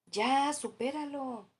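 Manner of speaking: "Ya, supéralo" agudo, lento y más fuerte, con desdén; burla de cliché. Retold or imitated. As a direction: imita a alguien insensible que despacha el dolor. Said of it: "A ver si te identificas". (imitación despectiva, aguda y lenta)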